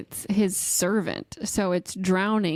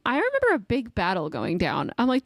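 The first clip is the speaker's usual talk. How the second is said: ordinary talk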